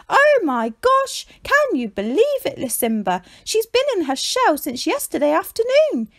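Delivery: high, loud, excited squeals; excited animal voice, leaping about; excited exclaiming. A narrator's reading. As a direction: bounce with excitement, voice way up. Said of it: "as he exclaimed to Lucinda".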